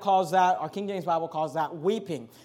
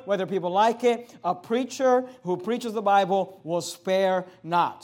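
ordinary talk

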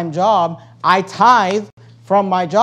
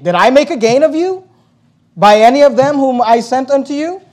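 raised, forceful rhetorical questions; emphatic scripture reading; 4 semitones higher, 6 dB louder than usual. Acted. Preacher reading the apostle's questions aloud, emphatic and challenging. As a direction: read it out as a challenge to the congregation.

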